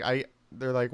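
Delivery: ordinary talk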